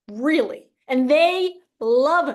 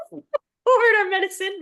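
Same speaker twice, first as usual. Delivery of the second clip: laughing; 8 semitones higher than usual. Real, not acted. Laughing while answering joke.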